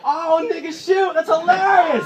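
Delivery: loud, high laughing outburst; laughing exclaim. Retold or imitated. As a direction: burst out laughing at the joke. Laughing at buddy's joke.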